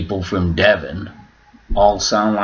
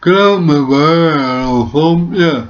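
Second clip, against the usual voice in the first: imitating the soap actor; 8 semitones higher, 4 dB louder, slower than usual. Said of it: "And he just go".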